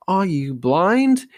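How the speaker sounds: high, loud, slow aghast question; slow loud aghast cry; gasping disbelief. Acted. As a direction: gasp it out in shock. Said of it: "he gasped".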